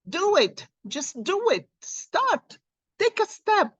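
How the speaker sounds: high, pushing exhortation; urgent pep talk. Real, not acted. Urging viewers to just start, high and eager. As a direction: fire them up to start now.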